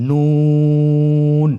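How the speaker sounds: নুন with the vowel held very long and thin; slow held recitation of নুন; long drawn vowel. Shown as a demonstration. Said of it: "আমার সাথে বলুন".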